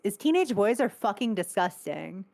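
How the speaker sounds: usual speaking voice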